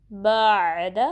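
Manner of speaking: बाद with ayn sounding on from mid-throat; बाद drawn smoothly through the ayn; flowing ayn. Shown as a demonstration. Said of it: "आइन के लिए आवाज़ जारी हो जाएगी".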